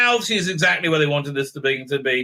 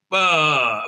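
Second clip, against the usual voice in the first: ordinary talk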